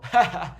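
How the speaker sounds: short chuckle at own silly example; amused laugh. Real, not acted. Teacher amused by the wrong hijo, brief laugh.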